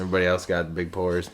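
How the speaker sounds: usual speaking voice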